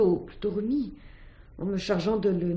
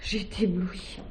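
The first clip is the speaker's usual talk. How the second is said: hushed whisper; 6 dB quieter than usual. Acted.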